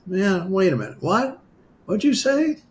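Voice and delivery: high-pitched incredulous double-take; startled disbelief. Retold or imitated. Asking an unseen voice to repeat itself, startled disbelief. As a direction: act out a baffled question, pitch climbing.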